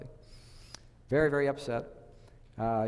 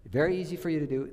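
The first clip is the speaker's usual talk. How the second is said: usual speaking voice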